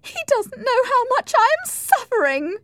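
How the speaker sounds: very high, loud wail of protest; distraught, high-pitched outburst; anguished cry. Acted. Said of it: "cried Isabella".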